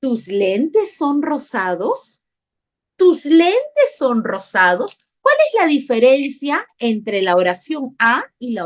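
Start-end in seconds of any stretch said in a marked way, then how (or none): 0.0-2.2 TUS LENTES SON ROSADOS slowly, rising into a question; question intonation. Shown as a demonstration. Teacher showing sentence B to pupils, asked slowly. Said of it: "Oración B". 3.0-5.0 TUS LENTES SON ROSADOS slow and higher, ending upward; question intonation. Shown as a demonstration. Teacher repeating sentence B for the class, asked slowly. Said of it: "Oración B".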